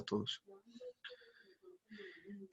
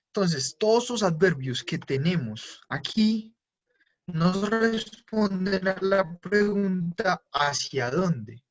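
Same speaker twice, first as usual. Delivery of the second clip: slow and deliberate; 3 semitones higher, slower than usual. Shown as a demonstration. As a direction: stretch out the key question, slowly. Profesor recalca la pregunta clave. (lento y marcado, recalcando la pregunta)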